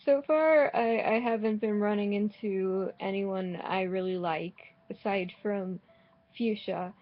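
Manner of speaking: ordinary delivery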